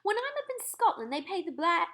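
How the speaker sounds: gracious encouragement; the Queen. Acted. Narrator voices the Queen.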